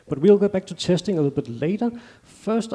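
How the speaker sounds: ordinary talk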